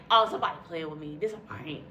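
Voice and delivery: low, fast muttering in disbelief; muttered to herself. Retold or imitated. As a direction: mumble it under your breath, half to yourself.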